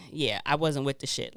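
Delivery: ordinary delivery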